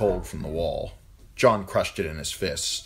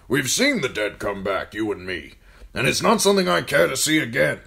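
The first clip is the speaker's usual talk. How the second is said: put-on raised, loud commanding old man; gruff old lord commander; 7 semitones higher, 7 dB louder than usual. A narrator's reading. Old commander ordering John to serve food, loud and gruff. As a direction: bark orders at the boy like an old soldier. Mormont voiced in story.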